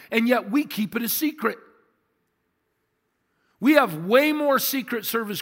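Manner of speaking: high, driving sermon delivery; impassioned preaching. Real, not acted. Impassioned preaching on early church.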